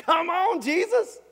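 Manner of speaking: high laughing protest at Jesus; laughing mock scolding. Preacher teasing Jesus over the ghost act, laughing. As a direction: chide him through a laugh.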